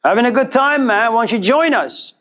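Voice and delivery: high, bubbly invitation; mock cheerful. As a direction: beam and wave him over. Happy teen inviting friend.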